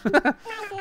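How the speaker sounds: breathy giggle. Real, not acted.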